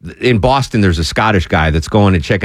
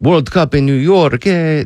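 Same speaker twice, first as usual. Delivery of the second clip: put-on a half-hearted French accent that drifts into Italian, pitched higher; lightly put-on French accent ending on a rising eh; mock French accent; 6 semitones higher than usual. Host mocking a French fan, in a hammed-up French accent that slips toward Italian. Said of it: "that was mostly Italian".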